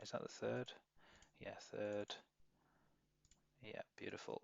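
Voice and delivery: very soft, absent-minded self-talk; muttering to self. Real, not acted. Trader hunting through chart settings, very quiet muttering. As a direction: think aloud under your breath while searching. Searching the chart, talking to himself.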